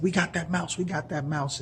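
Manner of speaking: high, gleeful triumphant cry; the farmer's wife thrilled to catch it; excited wife. Acted. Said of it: "she was like".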